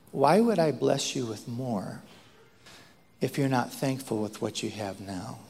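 lightly voiced divine question, low; God. God gently challenging the speaker, hint of a divine voice. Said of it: "then God spoke to me one last time".

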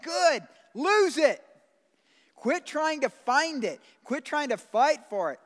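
loud, high, commanding exhortation; full-force preaching. Acted. Preacher telling the church to lose it, full-force preaching. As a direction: drive each short command home.

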